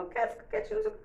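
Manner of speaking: put-on high, fast little child's voice; imitating a child. Child telling mother, high and quick, wanting to be alone. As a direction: pipe it up quickly like a small child. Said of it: "Às vezes a criança falava".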